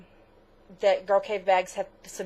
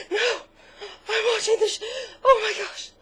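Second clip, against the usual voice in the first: high, louder imitation of her own sobbing; retold sobbing; 14 semitones higher, 5 dB louder, faster than usual. To her husband on the phone, a retold tearful wail. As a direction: replay the teary wail, high. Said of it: "sobbing and crying".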